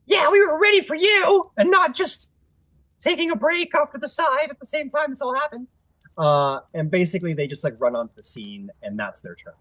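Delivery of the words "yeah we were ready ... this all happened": very high, louder, sheepish bluff; squeaky kobold boast. Acted. Kobolds boast they were ready.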